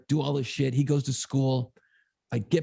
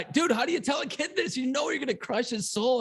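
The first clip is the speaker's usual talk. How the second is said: pitched way up in disbelief; high exasperated; 10 semitones higher than usual. Retold or imitated. Dad to himself, dreading telling his son, pitched high. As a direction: voice jumping up, stunned and helpless.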